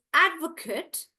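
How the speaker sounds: "advocate" slow, stressed on AD; "advocate" with reduced last syllable; noun pronunciation. Shown as a demonstration. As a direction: say "advocate" as "AD-vuh-kut". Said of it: "So noun is".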